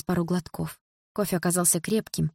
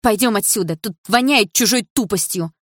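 louder, higher scornful remark; loud contempt; 4 semitones higher, 5 dB louder than usual. A narrator's reading. Дилара pulls Лёха away, loud and scornful. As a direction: say it loud for all to hear. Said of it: "громко сказала она".

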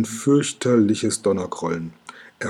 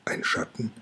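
whispered question; 10 dB quieter than usual. A narrator's reading. Kokosnuss asks in the dark hall.

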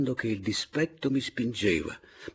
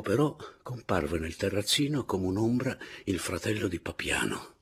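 plain reading voice

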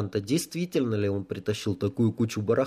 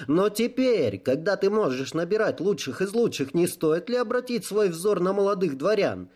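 put-on higher, eager young voice; young questioner; 9 semitones higher than usual. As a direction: pitch it up like a young nobleman. Young voice from the hall. (молодой голос из зала, настойчиво)

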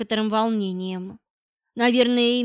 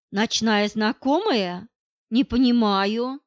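ordinary delivery, nothing marked

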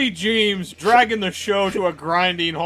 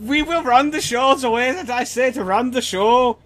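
put-on German accent; 7 semitones higher, 3 dB louder than usual. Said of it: "very bad German accents".

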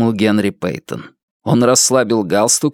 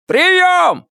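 yelling over the radio. A narrator's reading. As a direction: bellow the question over the crackle. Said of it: "проорал Ван Аллен".

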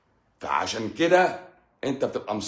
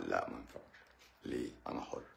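lowered voice; 12 semitones lower, 16 dB quieter than usual. Real, not acted. Host answering the viewer's mic request, voice lowered. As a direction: drop the voice right down, almost breathless. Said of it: "أوطي صوتي كده بقى".

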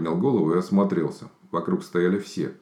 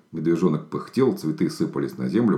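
usual speaking voice

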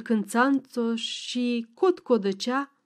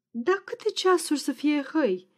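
put-on old man asking through a light yawn; higher, as if yawning while you ask; yawned question; 5 semitones higher than usual. A narrator's reading. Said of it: "căscă iar bătrânul".

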